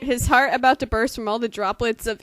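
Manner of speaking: loud, hammed-up dramatic reading. Friend reading a gross fanfic aloud, loud mock-dramatic reading. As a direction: belt out the fanfic like a stage performance. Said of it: "I'm just gonna read this like a woman".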